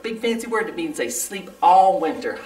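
ordinary delivery, nothing marked

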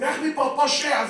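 put-on high, loud neighbor shouting at a kid; acted shout at a noisy boy; mock angry yell. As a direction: chase him off from the window, hammed-up. Neighbors yelling from windows.

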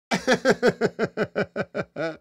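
high staccato run of chuckles; real laugh. Real, not acted. Streamer tickled by his chat, long high laugh. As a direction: laugh it out in quick high pulses.

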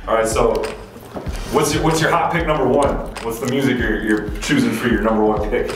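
usual speaking voice

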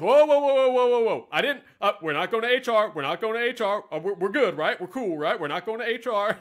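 loud, high, rushed walk-back; panicked backpedal. Retold or imitated. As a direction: instructor scrambling to take it back. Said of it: "he goes".

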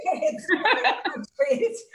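conceding the count with a laugh. Real, not acted. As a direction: say it through an amused laugh. Laughs after host corrects count.

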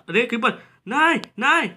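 high, loud cry of panic at the creeper; panicked shout. Real, not acted. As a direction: yell no as the creeper explodes. Creeper about to blow up house. (घबराकर ऊँची आवाज़ में चीख)

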